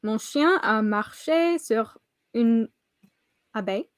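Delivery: slow, careful French; hesitant schoolbook French; French sentence. Shown as a demonstration. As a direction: read it out slowly in French. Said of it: "you want me to, should I".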